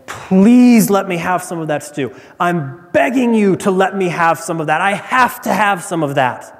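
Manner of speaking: begging. Acted. Hungry Esau to Jacob over stew, begging. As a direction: beg for it urgently, hammed up. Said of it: "he's begging for it".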